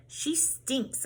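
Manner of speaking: pinched-nose voice; holding nose. Acted. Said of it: "holding my nose".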